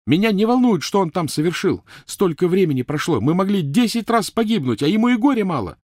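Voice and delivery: high, loud outraged rant; furious screaming. Acted. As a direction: keep shrieking over everyone. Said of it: "завопила Гермиона".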